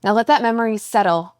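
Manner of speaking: measured meditation voice with long pauses; guided meditation. Acted. Coach guides a listener's walk and breath, guided meditation. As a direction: guide each step and breath, leaving space between phrases.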